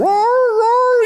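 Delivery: very high, drawn-out mock howl; imitated dog howl. Pastor mimicking his shot dog in the field, high howl. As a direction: yowl like a dog that's been hit.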